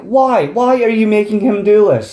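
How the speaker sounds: high-pitched, incredulous protest; exasperated. Retold or imitated. Exasperated reaction to robber.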